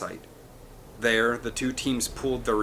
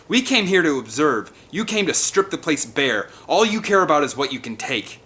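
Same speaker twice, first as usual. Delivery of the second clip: higher, louder angry outburst; exploding in anger; 5 semitones higher, 5 dB louder than usual. A narrator's reading. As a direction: tear into the hunters. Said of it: "Nick exploded".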